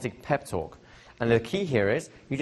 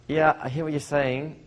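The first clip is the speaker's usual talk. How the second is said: unconvinced agreement; 5 semitones higher than usual. Retold or imitated. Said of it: "or like".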